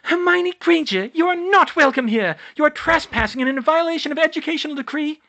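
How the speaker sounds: very high, loud indignant shout; outraged official in a piercing high voice. A narrator's reading. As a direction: screech the accusation from on high. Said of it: "broken by Umbridge shouting".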